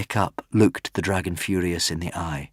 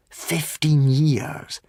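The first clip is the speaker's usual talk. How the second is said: high, very slow "Fifteen years"; "Fifteen" drawn out high and slow; stunned repeat; 7 semitones higher, much slower than usual. Acted. Fishlegs repeating it to himself, stunned.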